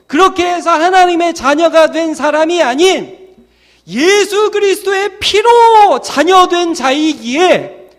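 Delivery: high, shouted proclamation; full-force preaching. Real, not acted. Preacher proclaiming children by Christ's blood, full-force. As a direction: cry it out to the congregation, drawing it out.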